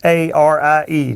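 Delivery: slowly, letter by letter; spelling it out. Real, not acted. Host spells a town he won't pronounce, slowly letter by letter. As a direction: spell each letter separately and deliberately.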